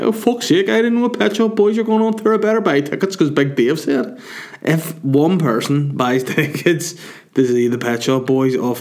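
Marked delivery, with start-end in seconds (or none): 0.0-4.2 higher mock fan voice; mock listener, higher; imagined listener. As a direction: play a gullible listener. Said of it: "imagine people were listening to this podcast being like".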